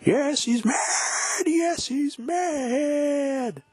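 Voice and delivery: very high, very slow hammed-up yell; drawn-out shout. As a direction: stretch out a mad, wailing cry. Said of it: "he goes".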